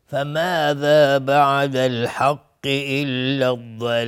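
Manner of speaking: slow, drawn-out melodic Quranic chanting; Quran recitation. Shown as a demonstration. Tajwid teacher reciting a verse for students, slow chant. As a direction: chant the verse with long held vowels.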